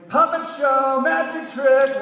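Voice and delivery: high, loud sing-song squeal. A narrator's reading. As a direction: squeal it with manic glee. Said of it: "the whole time squealing".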